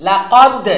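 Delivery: "লাকাদ" loud, with a hard bounced d; strong qalqalah push. Shown as a demonstration. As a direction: punch the end of "লাকাদ" loudly into an echo. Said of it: "একটু ধাক্কা জোরে দিতে হবে".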